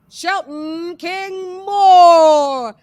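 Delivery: high, loud, slow drawn-out announcement; ring announcer call. Acted. Host bringing Shelton in like a fight announcer, loud and slow. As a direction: stretch the name like a boxing announcer.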